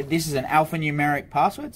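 usual speaking voice

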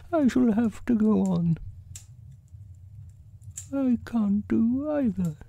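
very high bear voice; high storybook bear in trouble; Pooh. A narrator's reading. Pooh stuck in the hole, high fretful voice.

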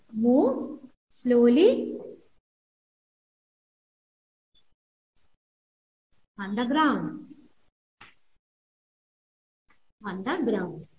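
drawn out, slowing and fading; acted slowly. Shown as a demonstration. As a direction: let the words creep along slowly. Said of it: "Creep means move slow".

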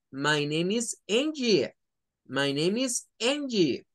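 slow "My name is Angie"; model sentence. Shown as a demonstration. Teacher models an introduction with the name Angie. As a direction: say it slowly and clearly. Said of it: "diríamos".

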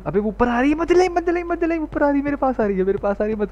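very high frantic cries; real terror, pitch way up; panicked shrieking. Real, not acted. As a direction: scream that it's coming for you. Doll coming at him, panic.

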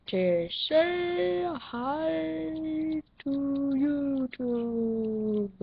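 very slow, hushed sing-song; soft drawn-out greeting. Pretend. Gamer greeting YouTube viewers, soft and drawn out. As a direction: stretch every word out softly.

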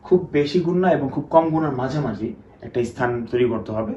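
normal talking voice

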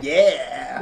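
a bright, loud little whoop; mock hero cheer shouted to his team; excited cheer. Gamma two cheering to everybody.

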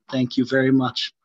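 normal talking voice

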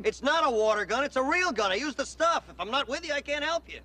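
high-pitched, agitated insistence; exasperated, worked-up explanation; heated arguing. Acted. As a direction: insist urgently, voice pushed high. Heated argument after mugging.

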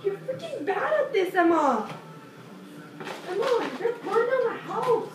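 real annoyed telling-off; yelling. Real, not acted. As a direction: yell at your sister for burning things. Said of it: "stop yelling at me".

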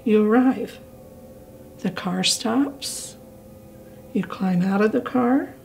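slow, calm script reading with long pauses; calm guided imagery. Shown as a demonstration. Leading older adults to imagine the lake, calm and slow. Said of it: "with a calm, soft voice. Go slower and softer".

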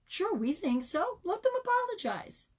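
put-on class of kids agreeing. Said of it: "came from the class".

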